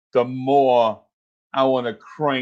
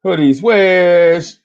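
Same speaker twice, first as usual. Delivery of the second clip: louder, slow shout-out; calling out; 5 semitones higher, 7 dB louder, much slower than usual. Real, not acted. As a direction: call the name out big. Calling out a chat name.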